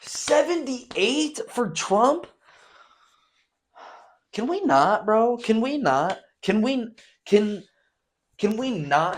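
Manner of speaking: high loud pleading; high, loud, drawn-out whine; exasperated outburst. Real, not acted. Exasperated at Trump's age.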